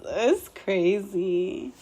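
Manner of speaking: ordinary talk, nothing marked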